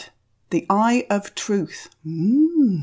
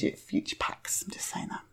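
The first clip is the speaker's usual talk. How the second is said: whispered aside; 12 dB quieter than usual. Real, not acted. Whispered aside after a complaint.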